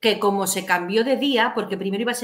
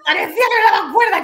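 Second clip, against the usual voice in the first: excited exclaim; 12 semitones higher, 9 dB louder than usual. Retold or imitated. Excited retelling, loud high.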